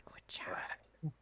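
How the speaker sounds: soft unvoiced aside; whispering. Real, not acted. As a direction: whisper it to yourself. Aside while moving tokens.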